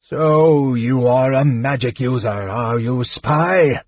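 slow-rolling menacing dragon voice; dragon's taunt, rising on the last word; shouting dragon. A narrator's reading. Dragon taunting a magic user as spy, menacing shout. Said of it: "Pyros shouted".

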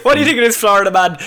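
ordinary talk, nothing marked

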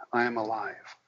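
very soft and meek; very timid. Retold or imitated. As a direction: imitate a timid client barely daring to say it. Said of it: "very timidly, they go".

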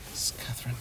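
hushed aside, barely voiced; whisper. Real, not acted. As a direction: drop to a whisper mid-call. Whispers as her phone rings.

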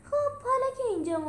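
high, upbeat moving on; bright, eager high voice; happily. Acted. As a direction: brush it off cheerfully, voice lifting. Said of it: "با خوشحالی پرسید".

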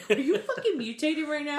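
laughing mock alarm; amused disbelief. Real, not acted. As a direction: tease him through laughter. Teasing co-host after his noise.

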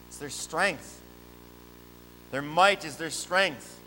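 ordinary talk, nothing marked

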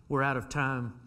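usual speaking voice

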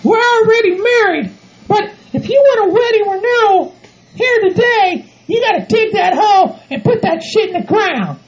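high falsetto ultimatum; threatening bossy partner. Acted. Bride threatening to call off wedding, bossy. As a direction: threaten him in a high put-on voice.

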